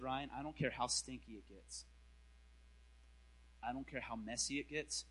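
quiet, low divine insistence; soft voice of God. Retold or imitated. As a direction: speak as a gentle but unyielding God. God's voice quoted softly.

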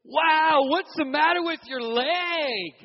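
slow high concerned "wow"; high, friendly guy voice. Retold or imitated. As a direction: play the surprised, caring ukulele guy. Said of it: "He goes".

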